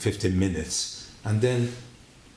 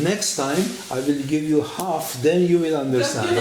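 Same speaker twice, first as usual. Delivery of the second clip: annoyed threat; 5 semitones higher, 5 dB louder than usual. Retold or imitated. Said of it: "he was angry".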